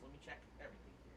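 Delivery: hushed, fast whisper. Real, not acted. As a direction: whisper it quickly under your breath. Checking the house, off mic.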